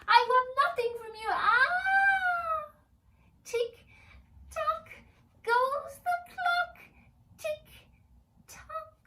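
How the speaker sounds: high, loud cry and chant; screeching tormented elf. Acted. Tormented elf shrieking the clock rhyme at Jamie. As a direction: shriek through the pain.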